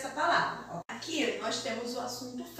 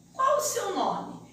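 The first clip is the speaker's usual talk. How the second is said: higher, slower, asking Qual clearly; question tune; 8 semitones higher, slower than usual. Shown as a demonstration. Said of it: "Eu tô fazendo uma pergunta". (entonação de pergunta, mais aguda e lenta)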